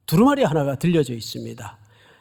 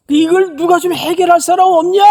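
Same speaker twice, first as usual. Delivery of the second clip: much higher and louder exasperated cry; desperate shout; 13 semitones higher, 8 dB louder than usual. Retold or imitated. Preacher voicing the world's cry, loud desperate shout. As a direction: yell it like nobody can fix it. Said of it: "이렇게 소리 지르는 거예요".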